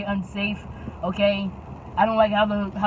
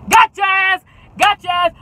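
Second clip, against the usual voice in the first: loud, higher gloating taunt; 8 semitones higher, 8 dB louder than usual. Retold or imitated. Boy taunts the driver he'll get fired, loud gloating. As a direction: crow over getting her fired.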